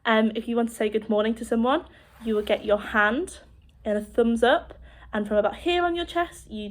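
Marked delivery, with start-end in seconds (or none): none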